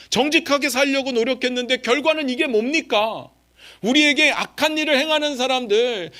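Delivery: high, insistent voice of grievance; acted indignant protest. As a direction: pile up aggrieved questions, pressing harder. Acting out believers' complaint to God. (억울함을 높은 목소리로 따져 묻듯)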